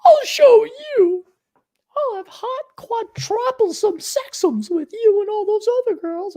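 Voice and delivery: very high put-on girl voice; hammed-up high-pitched comeback; mock defiant girlfriend. Acted retort from one of the girls.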